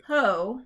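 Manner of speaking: loud, firm PO on its own; stressed syllable. Shown as a demonstration. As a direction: hit PO as the strong beat. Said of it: "slash mark for the stress one".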